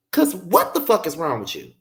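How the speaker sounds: ordinary talk, nothing marked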